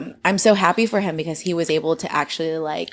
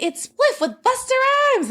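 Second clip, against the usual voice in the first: excited. Retold or imitated. Excited retold friend.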